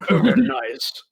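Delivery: high, exaggerated posh approval; hammed-up posh delight; poshest accent. Shown as a demonstration. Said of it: "The poshest accent you can do".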